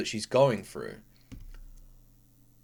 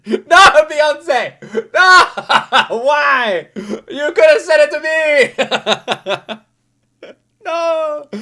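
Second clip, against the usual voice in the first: loud, high laughing cries of no; laugh-shout in mock despair; real laughing outcry; laughing protest; 10 semitones higher, 8 dB louder, much slower than usual. Real, not acted. Reacting to video, laughing cries.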